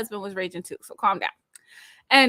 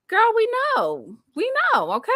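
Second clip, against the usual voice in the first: pitched way up, sassy and knowing; 12 semitones higher, 3 dB louder than usual. Real, not acted. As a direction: tell her you see right through her. Mocking Tisha's team wife act.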